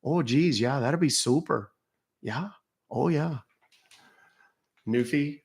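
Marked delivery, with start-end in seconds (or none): 0.0-3.4 light Fargo Midwest lilt, sing-song vowels; a folksy "Oh, geez" in Fargo Minnesota style; Fargo Minnesota accent. Pretend. Answering a viewer's request, a light Fargo Minnesota accent. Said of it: "I'd talk like that for two days".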